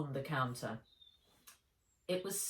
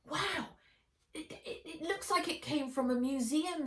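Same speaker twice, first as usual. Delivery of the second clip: high-pitched, stammering wonder; amazed; 11 semitones higher than usual. A narrator's reading. Boy seeing the ancient package, amazed. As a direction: gasp it out in boyish awe.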